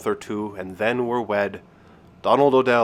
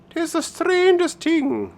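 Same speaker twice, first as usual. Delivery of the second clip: high, drawn-out Irish woman's voice; puzzled Irish countrywoman; 4 dB louder, slower than usual. Acted. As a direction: puzzle aloud in a high, slow Irish lilt. Woman puzzles over leaking roof.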